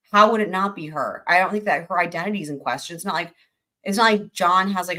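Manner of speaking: normal talking voice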